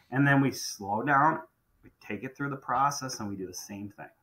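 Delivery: normal talking voice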